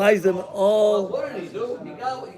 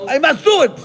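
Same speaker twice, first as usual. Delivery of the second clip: much higher, louder exclamation; determined cry; 10 semitones higher, 8 dB louder, faster than usual. Acted. Maria deciding to take the boy to church, determined cry. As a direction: burst out with sudden resolve.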